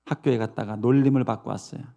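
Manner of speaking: ordinary talk, nothing marked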